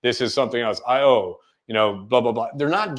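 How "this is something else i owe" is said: movie star line delivery. Shown as a demonstration. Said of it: "our favorite actors aren't just giving us the line".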